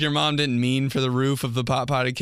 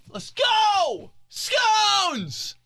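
excited cheer; much slower than usual. Pretend. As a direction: cheer it way up high.

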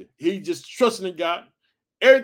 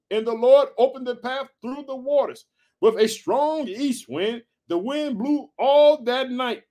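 measured, slightly raised reading voice; scripture reading; 4 semitones higher than usual. Acted. Pastor reading Exodus to the congregation, measured reading voice. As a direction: read the Bible passage out steadily.

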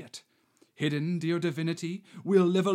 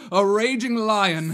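raging shout; 5 semitones higher, 10 dB louder than usual. Acted. Masked suitor roaring at the girl, loud raging bellow. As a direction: bellow it out like a lion. Straforel plays a jealous lion.

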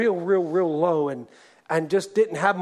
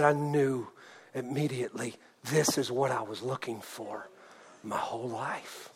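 low, very soft, slowing at the end; hushed testimony; 8 semitones lower, 10 dB quieter than usual. Retold or imitated. Preacher quotes Travis on his lifelong search, hushed. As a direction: let the quote sink almost to a hush. Retells convert's moved testimony.

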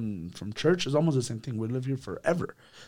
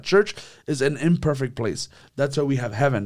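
ordinary talk

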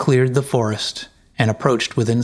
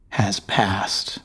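whispered taunt; 3 semitones lower, 4 dB quieter than usual. Acted. Wife taunting her husband.

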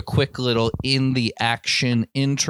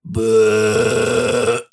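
a short mock burp; burp impression; 4 dB louder than usual. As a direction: imitate a little dog's burp. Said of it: "my best impression of a puppa burp".

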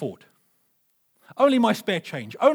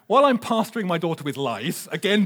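normal talking voice

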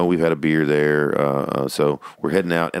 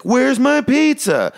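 very high, loud, slow mock wail; Jerry Lewis; 5 dB louder, much slower than usual. Son acting robbed of his pizza, mock wailing. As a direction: draw out the hammed-up outrage way up high. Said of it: "like in this Jerry Lewis".

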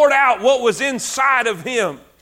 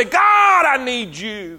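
crying out to God; slower than usual. Acted. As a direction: call out to God, then let it fall. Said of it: "crying out to God and say".

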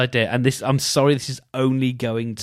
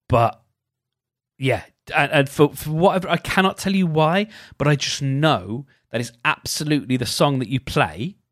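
ordinary delivery

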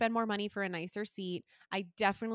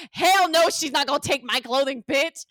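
loud, indignant refusal; defiant outburst; 2 semitones higher, 8 dB louder than usual. Retold or imitated. Passenger refusing to give up her clothing, defiant. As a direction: belt it out in retold outrage.

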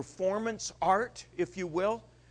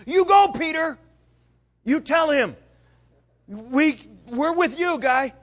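loud, high egging on; rowdy crowd urging Peter on; cheering disciples; 10 semitones higher, 11 dB louder than usual. Retold or imitated. Other disciples behind Peter, loud and high cheering.

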